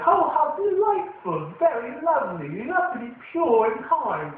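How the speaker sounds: put-on high, slow posh British accent; mock British gentleman accent. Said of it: "he has a stereotypical British accent".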